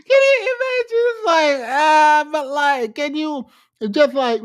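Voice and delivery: high, squealing laughter through the words; high-pitched giggly wail; cracking up. Real, not acted. Cracking up at the man's voice.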